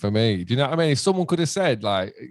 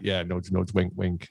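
quieter mock-conspiratorial aside; sly insinuation; 3 semitones lower, 6 dB quieter than usual. Fan replying to a denial, sly and hushed. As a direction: imitate a knowing fan, keeping it low.

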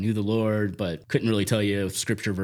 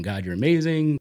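high, loud, eager praying; pious prayer voice; 7 semitones higher, 4 dB louder than usual. Retold or imitated. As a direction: gush praise at God. Retold overly pious prayer.

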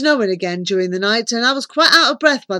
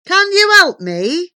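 high, slow hopeful appeal; 10 semitones higher, 6 dB louder than usual. A narrator's reading. Visitor asks the giant wizard for help, hopeful. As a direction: ask it brightly raised, drawing it out. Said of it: "I asked hopefully".

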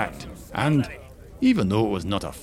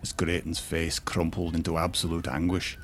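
usual speaking voice

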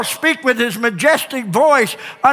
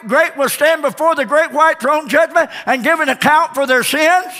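building preaching; 4 semitones higher than usual. Acted. Preacher pressing the congregation, building to full force. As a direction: climb each repeat higher.

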